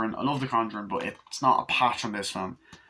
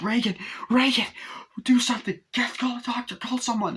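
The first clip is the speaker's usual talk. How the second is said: panicked mother; 14 semitones higher than usual. Retold or imitated. Mother panics at shaking room.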